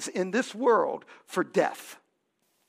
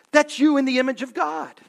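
louder, faster, hammered home; loud proclamation; 4 semitones higher, 9 dB louder, faster than usual. Acted. Pastor proclaiming to the congregation, loud and fast. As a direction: declare it boldly. Pastor repeats the line with force.

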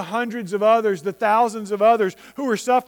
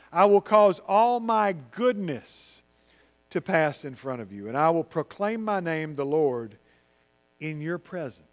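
scripture reading; 5 semitones lower, 3 dB quieter than usual. Acted. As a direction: read the verse gently, lower.

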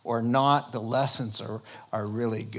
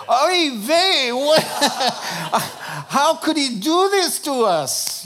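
high, loud, hammed-up Yiddish accent; 12 semitones higher, 7 dB louder than usual. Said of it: "I will show you how you do it".

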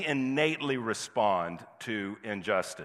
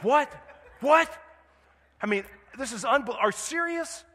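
mock outrage; 12 semitones higher than usual. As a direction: play the fouled player's outraged disbelief. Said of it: "It's like".

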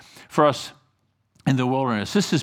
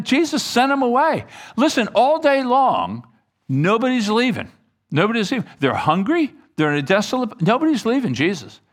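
frustrated complaint, higher pitched; resentful frustration; 6 semitones higher, 3 dB louder than usual. Acted. Disciples complaining to Jesus that nobody's leaving, resentful and frustrated. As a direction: vent mounting resentment at the whole situation. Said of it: "some degree of resentment and frustration".

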